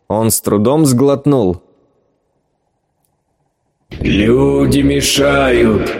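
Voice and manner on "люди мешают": slow, grumbling creature voice; monster grumble. Acted. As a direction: grumble it out slowly like a sea monster. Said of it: "буркнул голос".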